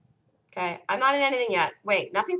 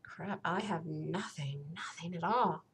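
dismayed mutter; 4 semitones lower, 13 dB quieter than usual. Real, not acted. Trader finding nothing on his screen, soft dismayed murmur. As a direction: sink into quiet dismay.

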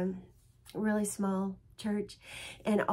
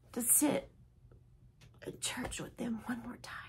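real tears, whispered and much softer; choked whisper; 9 dB quieter than usual. Real, not acted. Missing her family at church, real tearful whisper. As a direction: let the voice break into a whisper. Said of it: "I'm gonna start crying".

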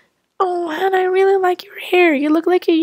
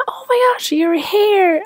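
shocked gasp; 5 semitones higher, slower than usual. Acted. Shocked mother sees dyed hair.

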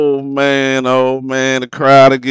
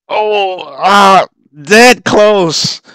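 anguished near miss; 8 semitones higher, 4 dB louder, much slower than usual. Real, not acted.

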